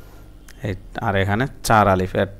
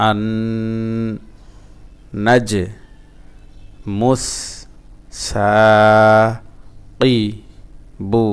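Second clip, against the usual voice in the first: আন নাজ মুস সা কি বু, a pause after each; broken-up syllables; slower than usual. Shown as a demonstration. Teacher drilling learners, halting syllable-by-syllable recitation. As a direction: chant one syllable at a time, holding আন and সা. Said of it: "ভাঙা ভাঙা উচ্চারণ".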